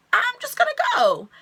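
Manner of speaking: very high put-on squeak; awkward exit. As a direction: imitate squeaking out an uneasy goodbye. Retold awkward exit line.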